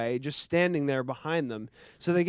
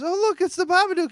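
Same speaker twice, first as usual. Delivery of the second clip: much higher, louder, quick mock-chirpy mother; cheery mom; 11 dB louder, faster than usual. Mimicking a mom showing her son the Babadook, much higher and louder. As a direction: chirp it like a mom pointing out a costume. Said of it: "and the mom goes".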